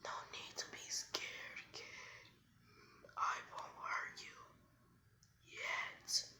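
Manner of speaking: slow, menacing whisper of a great beast; hushed monster voice; Nine Tails. Acted. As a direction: whisper it slowly as the big fox. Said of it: "the Nine Tails goes".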